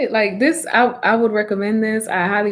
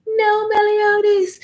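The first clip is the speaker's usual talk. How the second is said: high, whiny mock complaint; Meliodas voice; 13 semitones higher than usual. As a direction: whine it in a high put-on voice. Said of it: "And Meliodas".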